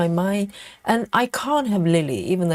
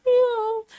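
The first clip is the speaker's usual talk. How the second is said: very high thin imitated meow; pitiful cat meow. Imitating her cat's pitiful meow for the stream. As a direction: mew like a sad little cat begging. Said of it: "really pitiful meow".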